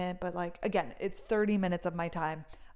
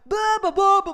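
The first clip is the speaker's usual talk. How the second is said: mock rant; 12 semitones higher, 10 dB louder than usual. Mocking guard's rant continues.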